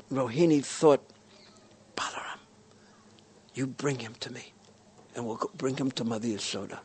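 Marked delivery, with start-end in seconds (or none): 2.0-6.8 hushed, quick secret plan; conspiratorial whisper. Acted. Rohini secretly plans with Balaram.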